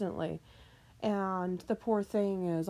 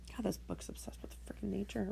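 whisper; 9 dB quieter than usual. Real, not acted. Muttered aside about the prompt book.

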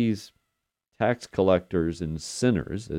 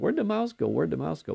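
put-on toddler's voice; 8 semitones higher than usual. Grandpa voicing his two-year-old granddaughter asking for the mouse. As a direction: plead like a small child who lost her toy. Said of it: "she just keeps asking".

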